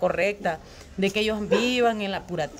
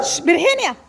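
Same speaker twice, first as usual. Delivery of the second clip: hushing the cat; 9 semitones higher, 6 dB louder, slower than usual. Real, not acted.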